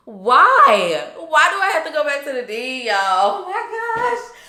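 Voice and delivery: hammed-up panic, whining in protest; nervous outburst. Student retelling the dread of returning to the dean, nervous wail. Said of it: "I was so nervous".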